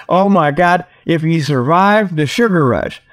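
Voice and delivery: ordinary talk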